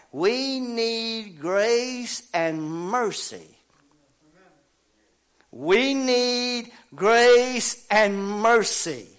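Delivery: very slow, drawn-out preaching call; intoned plea to the congregation; preacher's proclamation. Real, not acted. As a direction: stretch every word out, high and sustained.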